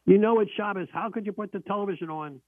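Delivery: shrill-free but high, scold the husband like an angry grandmother; grandmother yelling. Retold or imitated. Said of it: "She started yelling at him".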